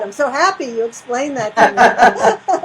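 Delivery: laughing loudly while speaking. Real, not acted. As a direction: burst into delighted laughter. Laughing delight.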